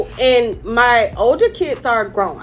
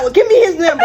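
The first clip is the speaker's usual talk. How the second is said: high, louder, laughing through the quote; laughing retelling; 9 semitones higher, 8 dB louder, faster than usual. Retold or imitated. Wife retelling husband's demand, laughing. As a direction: giggle out the husband's words. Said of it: "he was like".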